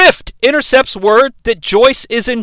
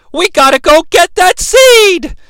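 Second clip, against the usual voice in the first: rallying cry; 8 semitones higher, 4 dB louder than usual. Retold or imitated. Acted rallying cry in retelling.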